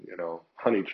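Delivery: ordinary delivery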